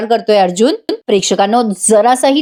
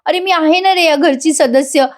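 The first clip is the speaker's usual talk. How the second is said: acted whine of a wronged wife; mock tearful plea; 6 semitones higher than usual. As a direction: plead like a wife putting on tears. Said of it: "ती आता रडायला लागते".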